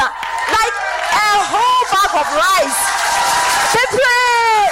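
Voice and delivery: rising excited cry of thanks. Real, not acted. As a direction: burst out with joy at the gift. Excitedly recounting bag of rice.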